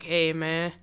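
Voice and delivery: lazy surfer-dude greeting; lightly imitated stoner drawl. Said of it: "kinda talks like".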